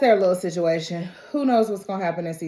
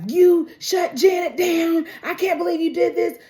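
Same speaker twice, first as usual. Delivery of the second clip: higher and louder, accusing; angry accusation; 10 semitones higher, 4 dB louder than usual. Retold or imitated. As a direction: throw the blame at her. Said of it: "And so Lulu's like".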